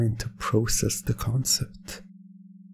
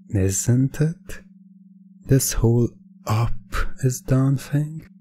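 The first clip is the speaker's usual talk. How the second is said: ordinary talk, nothing marked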